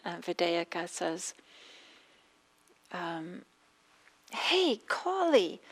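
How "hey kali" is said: higher, louder, slow summons to the maid; stern mistress calling. Retold or imitated. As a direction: draw the name out like a cross mistress. Mistress calls maid again.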